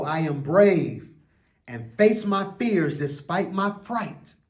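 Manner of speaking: put-on slow, lilting read-aloud for children; an expressive children's book reading; story reading. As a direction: read it like a bedtime picture book. Reads picture book aloud.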